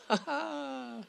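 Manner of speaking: high gleeful burst of laughter; delighted laugh. Real, not acted. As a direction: laugh out in triumph. Success after spotting elk.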